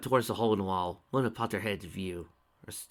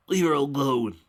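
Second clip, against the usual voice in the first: loud demand; 5 semitones higher, 9 dB louder than usual. A narrator's reading. Spike defends Rarity.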